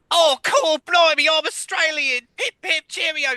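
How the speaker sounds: very high, loud, mock-British botched Australian accent; hammed-up Cockney attempt at Australian, shrieked high; terrible Australian accent.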